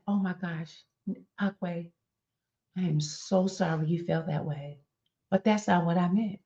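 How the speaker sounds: a sorry that excuses itself, gently; mock-sweet sorry with a but; fake apology. Shown as a demonstration. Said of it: "I turned around and said".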